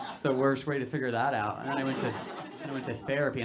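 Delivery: ordinary talk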